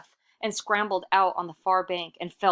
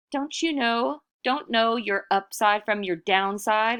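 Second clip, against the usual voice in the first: higher, louder mocking yell; 6 semitones higher, 5 dB louder than usual. Iktomi taunts the boulder alongside the bats, yelling. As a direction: taunt the rock at the top of the voice. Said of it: "Iktomi yelled".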